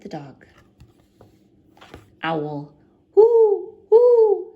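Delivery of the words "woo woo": high, loud hooting; owl hoot. A narrator's reading. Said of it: "says the owl".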